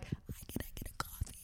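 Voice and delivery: voiceless raspy whisper. Retold or imitated. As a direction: croak it out with no voice left. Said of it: "that was my voice".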